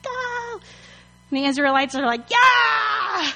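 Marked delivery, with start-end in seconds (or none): 0.0-0.6 long high mock scream; hammed-up high wail of panic; panicked fleeing. 2.3-3.3 high, drawn-out battle cheer; hammed-up triumphant shout; empowered. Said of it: "They're, like, so empowered".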